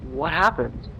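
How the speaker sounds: shaken. Acted. Shaken, asking quietly.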